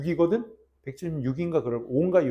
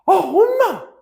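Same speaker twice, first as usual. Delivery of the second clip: put-on high, loud teenage whine at mom; hammed-up angry outburst; mock tantrum; 11 dB louder, much slower than usual. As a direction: act out a little sister snapping at her mother. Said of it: "막 승질을 부리는 거야".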